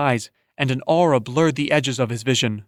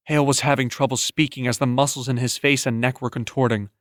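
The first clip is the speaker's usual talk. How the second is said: ordinary delivery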